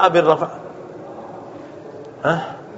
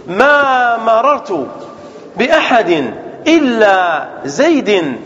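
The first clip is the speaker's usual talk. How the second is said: slow dictation; much slower than usual. Shown as a demonstration. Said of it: "teacher dictates example slowly".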